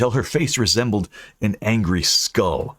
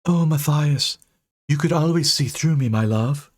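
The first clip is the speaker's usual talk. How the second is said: high, doting ghost voice; booming ghost; 7 semitones higher than usual. Acted. Ghost swoons over her lost fiancé. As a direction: swoon over him, unearthly. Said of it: "Her unearthly voice boomed".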